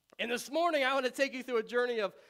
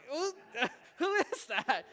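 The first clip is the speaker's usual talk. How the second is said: laughing disbelief; 4 semitones higher, slower than usual. Retold or imitated. Husband baffled by the phone voice.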